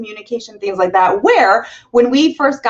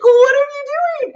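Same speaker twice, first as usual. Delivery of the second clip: exasperated cry; 3 dB louder than usual. Retold or imitated. Exasperated retold outburst.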